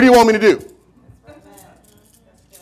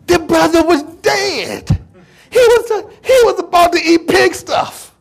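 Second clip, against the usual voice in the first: impassioned outcry; 7 semitones higher than usual. Real, not acted. Preacher pressing how lost the brother was, impassioned cry. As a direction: cry it out high, stretching the key word.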